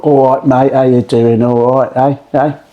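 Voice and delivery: a hint of a cockney barrow boy accent; chirpy cockney mate greeting, lightly put on; mock cockney geezer. Mimicking Jamie Oliver's fake cockney barrow boy greeting. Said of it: "that kind of barra boy, kind of working class kind of vibe".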